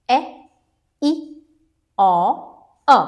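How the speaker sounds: each short vowel said alone, clipped and separate; short vowel sounds. Shown as a demonstration. Teacher to students, demonstrating short vowels, one at a time. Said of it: "इनका short vowel sound है".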